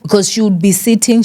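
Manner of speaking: normal talking voice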